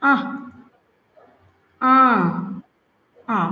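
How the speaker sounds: "আ" with no final r, open long ah; R without the r. Shown as a demonstration. Teacher shows R said as "আ" with no r. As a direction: drop the r, just "আ". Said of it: "আমরা আর এর শেষে রটা উচ্চারণ করব না".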